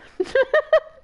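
giddy shriek of laughter; high squealing laugh. Real, not acted.

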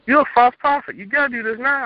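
high, loud jeering friend voice; mocking friend. Friend taunting him to keep sinning, high jeering voice. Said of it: "he's like".

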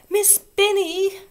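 high, loud, slow tearful cry; near tears. A narrator's reading. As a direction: wail for the teacher, almost crying. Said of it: "cried Susan on the verge of tears".

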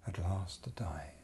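whispered dying phrase. Acted. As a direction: breathe it as a last breath. Whispered line.

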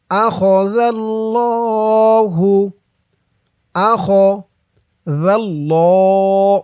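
أخذ with a soft ذ, الله drawn long; soft dhal. Shown as a demonstration. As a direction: say أخذ with a gentle ذ, slowly. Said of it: "জালের উচ্চারণটা নরম হবে".

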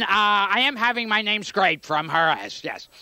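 normal talking voice

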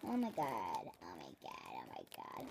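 very low slowed-down voice; deep gas voice. Real, not acted.

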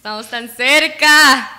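loud cry of near-miss frustration; exasperated cry. Real, not acted. Player so close to the goal, exasperated cry. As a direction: belt it out, leaning on the last word.

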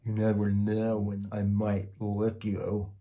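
slow, hammed-up scary monster voice; mock terrifying. A narrator's reading. Monster tries to scare the giggling child, slow mock menace. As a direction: try hard to sound frightening. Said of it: "trying to sound terrifying".

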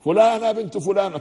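فلانة called out high and clear; the woman told to speak up, now raising her voice. Retold or imitated. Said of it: "ارفعي صوتك أكثر".

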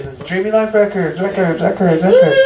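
booming reverb echo on the label name; announcer echo. Acted. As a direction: fake radio echo trailing off.

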